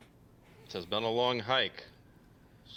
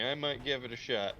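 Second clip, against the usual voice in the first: normal talking voice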